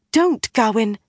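alarmed gasp. A narrator's reading. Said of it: "Min gasped".

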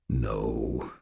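low, soft and gentle; quiet, resigned gentleness; gentle refusal. A narrator's reading. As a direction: refuse tenderly, dropping pitch and volume. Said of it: "he said in a gentle voice".

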